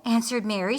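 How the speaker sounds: plain reading voice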